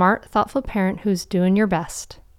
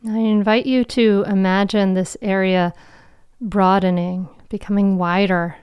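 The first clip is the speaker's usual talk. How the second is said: guided meditation. Acted. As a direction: guide them gently into calm.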